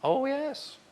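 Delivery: a light hint of the Queen's deadpan jab. Comedian lightly voicing the Queen's dry put-down. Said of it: "she went".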